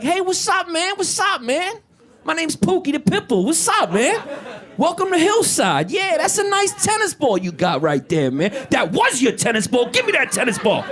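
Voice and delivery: high, swaggering tough-dog voice; Pookie the Pit Bull. Retold or imitated. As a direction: play a cocky yard bully greeting the new guy. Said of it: "the pit bull was like".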